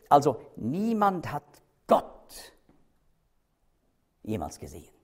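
emphatic breathy "Gott" in the middle of the verse; stress on Gott. Shown as a demonstration. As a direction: isolate "Gott", then let the rest fall away. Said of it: "dadurch wird das Wort betont".

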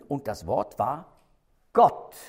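lingering build to a punched "Gott"; stress on Gott. Shown as a demonstration. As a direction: hold back, then land hard on "Gott". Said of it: "um zu betonen".

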